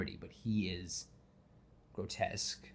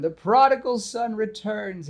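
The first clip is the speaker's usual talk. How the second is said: mock grand announcement; 12 semitones higher, 9 dB louder than usual. Mock announcing Harry's return.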